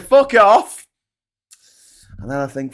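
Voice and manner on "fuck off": loud high burst, through laughter; shouted fuck off. Retold or imitated. A speaker telling a friend to back off, in a loud high yell. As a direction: bark it back half-laughing. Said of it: "And I'm like".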